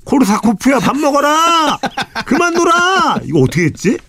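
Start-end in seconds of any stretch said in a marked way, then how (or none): 0.0-1.8 high, drawn-out mother calling home; calling kids to dinner. Pretend. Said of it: "이 시대는 어떻게 이름 어떻게 불러?". 2.3-3.2 high sing-song mother's call; calling kids to dinner. Pretend. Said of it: "이 시대는 어떻게 이름 어떻게 불러?".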